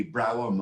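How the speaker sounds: Browham with the first syllable like "brow"; name pronunciation guess. Shown as a demonstration. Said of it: "I hope I pronounced your name right".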